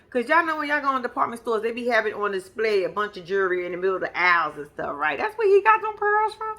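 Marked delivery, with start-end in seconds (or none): 5.2-6.6 incredulous. Real, not acted. Mocking cheap pearls gift.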